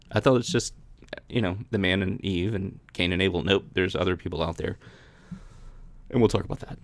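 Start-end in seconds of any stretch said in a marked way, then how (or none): none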